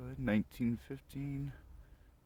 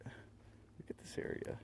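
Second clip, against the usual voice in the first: slow soft whisper; nervous night vlog whisper; 13 dB quieter, slower than usual. Real, not acted. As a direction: hush it as if someone might hear. Whispering in a graveyard.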